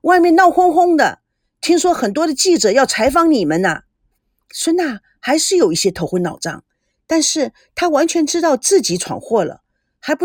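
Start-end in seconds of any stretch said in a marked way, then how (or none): 0.0-3.8 loud and high, a big booming voice; a loudmouth colleague announcing the news; loud-voiced. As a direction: call it out across the room. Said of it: "大嗓门的阿朱大声地说".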